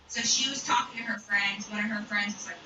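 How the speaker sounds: ordinary delivery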